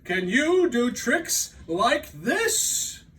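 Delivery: high, loud call; boastful cry. Acted. Toad boasting to Frog from the stage, loud call. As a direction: show off to your friend. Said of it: "said Toad".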